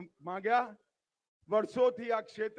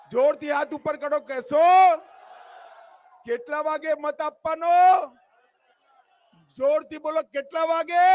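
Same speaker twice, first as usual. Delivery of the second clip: loud, raised rally questioning; rousing crowd call; 4 semitones higher, 12 dB louder than usual. Real, not acted. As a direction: fire questions out to a big crowd. (સભાને પૂછતો જોરદાર ઊંચો અવાજ)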